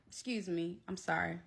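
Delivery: ordinary talk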